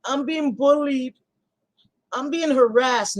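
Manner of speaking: slow put-on victim voice; mock victim whine. Streamer parodies playing victim, whiny and slow. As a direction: play the pitiful victim, drawn out.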